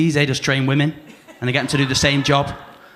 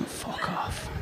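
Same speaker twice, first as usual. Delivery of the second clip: breathy whispered fuck off; whispered dismissal; 13 dB quieter than usual. Retold or imitated. Brother dismissing his sibling in a whisper. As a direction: hiss it under your breath.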